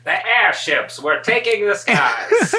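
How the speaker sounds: high, slow, drawn-out mock narration; grandly hammed-up, with a laugh underneath; old-time narrator parody. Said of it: "The year was nineteen fifty-four".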